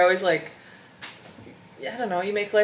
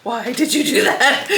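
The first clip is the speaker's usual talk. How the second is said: laughing; 3 semitones higher, 4 dB louder than usual. Retold or imitated. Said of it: "I'm like".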